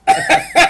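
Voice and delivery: high loud laugh bursting out; burst of laughter. Real, not acted. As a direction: crack up laughing. Laughing at own joke.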